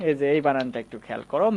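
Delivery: ordinary talk, nothing marked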